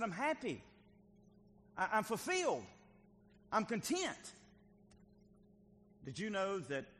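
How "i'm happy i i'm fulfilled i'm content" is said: high-pitched, emphatic preached exclamations; exultant declaration. Acted. Preacher voicing a happy, content David, exultant. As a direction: proclaim each phrase high, with pauses between. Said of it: "He said".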